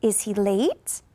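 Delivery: slow, "late" rises at the end; slow yes-no question rise; slow rising question. Shown as a demonstration. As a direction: lift "late" slowly. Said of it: "Listen to it again".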